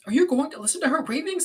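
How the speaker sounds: high, heated scolding; impassioned, high-pitched protest; passionate reproach. Acted. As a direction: demand it in indignation. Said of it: "I said passionately".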